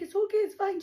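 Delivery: high, hurried mock comforting; anxious reassurance. Retelling how she calmed her scared friend, high-pitched reassurance.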